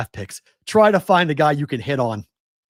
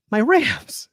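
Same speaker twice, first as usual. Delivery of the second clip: much higher, pained repeat of the team name; exasperated cry; 11 semitones higher than usual. Real, not acted. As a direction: cry out for your team in despair. Host laments his team's inaction.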